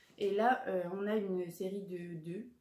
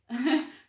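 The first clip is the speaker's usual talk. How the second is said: embarrassed laugh; 4 semitones higher, 10 dB louder than usual. Real, not acted. Laughs at buying only yaoi.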